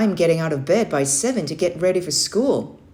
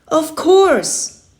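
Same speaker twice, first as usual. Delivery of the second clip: high, loud eager yell of agreement; shouted; 12 semitones higher, 10 dB louder than usual. Acted. Boy to Uncle Jake, shouting yes with excitement. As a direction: burst out with it, all fired up. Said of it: "I shouted".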